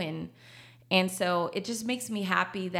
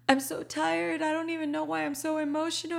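higher, tearful-sounding plea to her husband; a retold breakdown, voice pitched up; retold teary complaint; 8 semitones higher, faster than usual. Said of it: "And I started crying".

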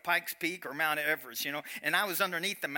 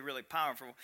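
ordinary talk, nothing marked

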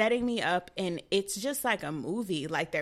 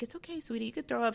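soft, higher sweet soothing voice; calm; 4 semitones higher, 4 dB quieter, much faster than usual. Retold or imitated. Lady soothing nauseous patient, calm and gentle. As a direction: imitate a tender, unhurried nurse. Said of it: "She's so calm".

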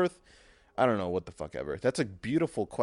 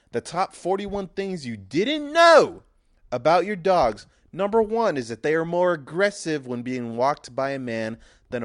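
louder, hammed-up lecture; raised ranting voice; 4 semitones higher, 6 dB louder than usual. Man lecturing his wife about dog walking, louder rant. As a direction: lay down the law to the wife.

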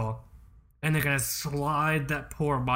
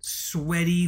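usual speaking voice

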